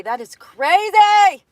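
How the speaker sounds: high, loud, slow amazement; stretched-out amazed exclaim. Real, not acted. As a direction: marvel at it out loud. Amazed at body change.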